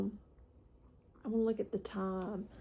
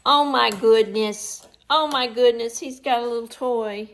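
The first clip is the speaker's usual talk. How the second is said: delighted; 15 dB louder than usual. Real, not acted. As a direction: gush over the puppy's toy. Delighted at dog's toy.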